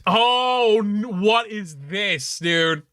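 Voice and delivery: stunned, high and dragged out what; real shock, stretching the words slowly. Real, not acted. Gamer shocked by a creepy poem, stunned and slow.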